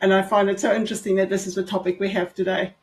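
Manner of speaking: usual speaking voice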